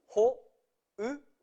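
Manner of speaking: syllable by syllable. Shown as a demonstration. Teacher showing learners the word for broom, syllable by syllable. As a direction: break HO-U-KI apart with pauses, ho ... u ... ki.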